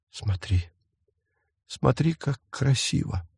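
soft, shaky whisper to the dead; trembling whisper. A narrator's reading. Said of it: "прошептала она дрожащими губами".

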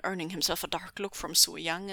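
ordinary delivery, nothing marked

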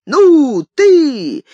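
loud, high driver's cry; urging the horse. A narrator's reading. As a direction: holler to spur the horse on. Ванька погоняет лошадь. (громкий окрик на лошадь)

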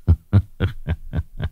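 soft, light staccato chuckle; old sage's chuckle. Acted. Old sage dismissing affairs of the throne, soft chuckle. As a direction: chuckle quietly to yourself.

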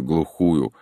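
plain reading voice

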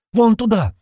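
high, loud urgent cry; shouting. A narrator's reading. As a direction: shout and point the way. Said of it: "закричала девушка".

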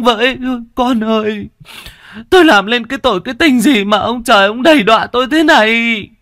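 high, loud sobbing lament; keen brokenly, stretching out the cries; wailing. Acted. Mother cries over her hanged daughter, loud high wailing. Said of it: "chạy đến mà gào khóc".

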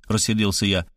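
ordinary talk, nothing marked

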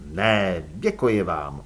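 low, grim refusal; gloomy. A narrator's reading. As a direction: turn it down darkly, voice dropped low. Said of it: "odpověděl Elánius zachmuřeně".

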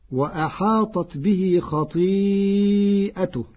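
slow, chanted tajwid recitation; Quran recitation. Acted. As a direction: recite it slowly, melodic.

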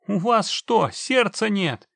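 high, loud, reproachful plea; desperate pleading. A narrator's reading. Man reproaches the indifferent witcher, desperate pleading. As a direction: implore him in despair. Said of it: "человечек принялся умолять".

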